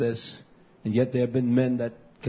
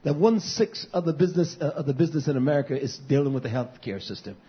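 ordinary talk, nothing marked

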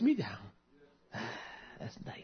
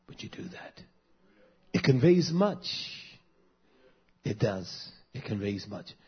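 normal talking voice

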